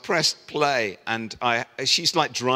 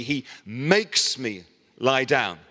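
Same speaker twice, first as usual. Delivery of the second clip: ordinary talk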